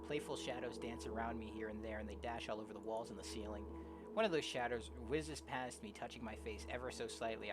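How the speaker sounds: low, soft, measured reading; story narration. Acted. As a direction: read the tale quietly and evenly. Reads game story aloud.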